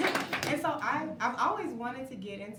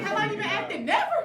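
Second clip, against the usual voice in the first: higher, louder teasing question with laugh; castmates asking; 10 semitones higher, 10 dB louder than usual. Retold or imitated. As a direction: play the amused castmates. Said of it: "They're like".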